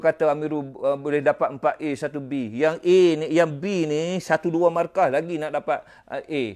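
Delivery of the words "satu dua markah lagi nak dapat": ordinary talk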